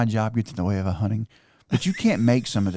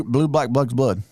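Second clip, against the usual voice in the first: tongue twister; slower than usual. Shown as a demonstration.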